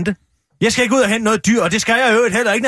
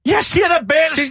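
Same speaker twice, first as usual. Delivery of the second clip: indignant; 6 semitones higher than usual. Real, not acted. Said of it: "du siger bæls".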